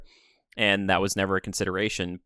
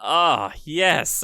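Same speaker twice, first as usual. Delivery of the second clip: normal talking voice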